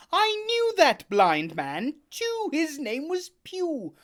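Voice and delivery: Jim telling. Acted. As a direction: tell it eagerly.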